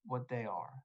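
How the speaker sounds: ordinary delivery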